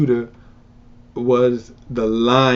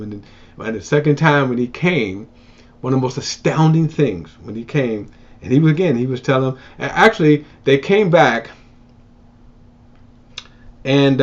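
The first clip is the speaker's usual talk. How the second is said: ordinary talk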